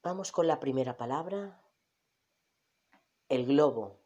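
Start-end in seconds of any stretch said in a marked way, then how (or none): none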